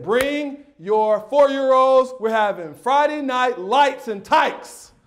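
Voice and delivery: slow, loud, hyped-up announcer delivery; grand announcement. Retold or imitated. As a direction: announce it like a big sporting event. Announces his meeting to parents.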